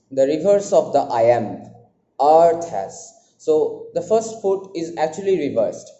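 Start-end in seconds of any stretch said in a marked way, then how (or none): 2.2-3.1 "Earth" loud and long, "has" lighter after it; stressed then unstressed. Shown as a demonstration. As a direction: hit "Earth", then let "has" drop. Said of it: "a syllable pair that is stressed".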